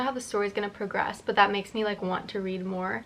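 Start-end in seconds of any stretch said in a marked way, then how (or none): none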